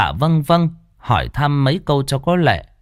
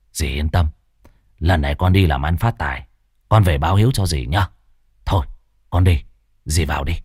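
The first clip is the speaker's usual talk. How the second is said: low reassuring goodbye; 7 semitones lower, faster than usual. Acted. Tuất bids his aunt farewell, low reassuring voice.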